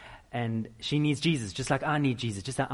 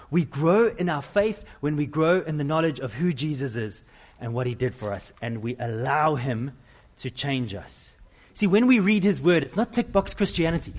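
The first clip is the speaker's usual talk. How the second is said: normal talking voice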